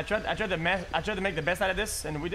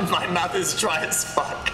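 ordinary talk, nothing marked

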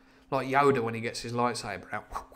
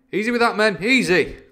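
high, loud warning to the crew; calling orders; 9 semitones higher, 11 dB louder than usual. Acted. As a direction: shout a caution to the men. Captain calling to men shifting powder.

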